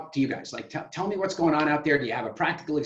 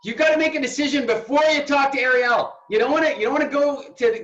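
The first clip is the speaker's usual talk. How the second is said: much higher, loud emphatic no; mock shout; 10 semitones higher, 5 dB louder than usual. Coach hamming up a shouted warning to decide first. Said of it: "I'm totally exaggerating".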